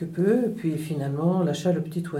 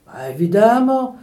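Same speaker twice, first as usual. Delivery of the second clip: loud, higher sarcastic exclamation; exclaiming; 4 semitones higher, 8 dB louder than usual. A narrator's reading. Peasant reacting to the third truth, exasperated exclamation. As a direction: burst out with exasperation. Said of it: "s'exclama le paysan".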